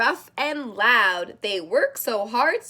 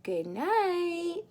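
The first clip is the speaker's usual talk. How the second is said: higher, gentle sing-song goodnight; high soft goodnight; 5 semitones higher, 6 dB quieter than usual. Acted. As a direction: lilt it up softly.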